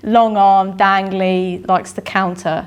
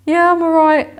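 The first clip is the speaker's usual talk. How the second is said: high and chirpy, covering panic; forced brightness; 9 semitones higher, 4 dB louder than usual. Retold or imitated. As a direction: sound falsely fine, pitched up. Hiding a panic attack behind a bathroom door.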